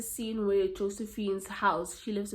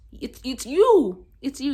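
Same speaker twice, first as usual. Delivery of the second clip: loud, higher, drawn-out accusation; mock scolding; 5 semitones higher, 12 dB louder, slower than usual. Telling off someone who lets anything happen, loud mock exasperation. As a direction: hammer the accusation, leaning hard on "you". Scolding an imagined passive person.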